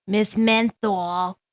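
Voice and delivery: drawn-out slow "Miss Menthol". Shown as a demonstration. Podcast host slowing her name for the transcript, slow. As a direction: say "Miss Menthol" slowly, each syllable stretched. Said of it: "say it slower".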